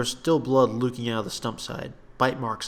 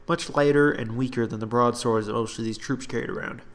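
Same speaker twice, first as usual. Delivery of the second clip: usual speaking voice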